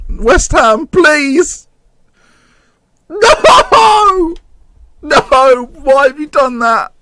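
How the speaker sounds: high desperate yelling; anguished shout. Real, not acted. Fan shouting as his team concedes. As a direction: scream no at the screen.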